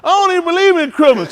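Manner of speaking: loud, high-pitched mock objection; hammed-up complaint with a laugh behind it; mock protest. Retold protest at the carols.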